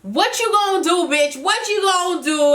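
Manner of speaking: high, taunting dare; mock challenge. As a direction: taunt her like you're daring a fight. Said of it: "Just Brittany's like".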